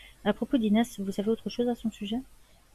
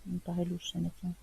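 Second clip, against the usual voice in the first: soft, suspicious inner thought; hushed aside; 8 dB quieter than usual. Acted. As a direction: mutter it privately, under your breath. (aparté soupçonneux à voix basse)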